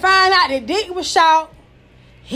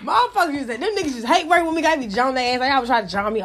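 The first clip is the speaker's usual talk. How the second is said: ordinary talk, nothing marked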